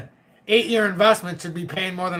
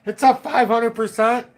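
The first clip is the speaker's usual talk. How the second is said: mock angry viewer; 5 semitones higher, 4 dB louder than usual. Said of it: "people in this audience that are sitting there going".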